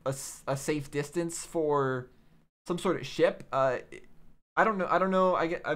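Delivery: ordinary talk, nothing marked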